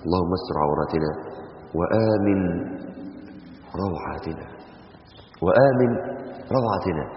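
soft, low chanted supplication; quiet devout du'a; prayer. Acted. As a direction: pray it softly with the congregation. Leads a supplication aloud.

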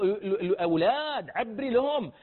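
normal talking voice

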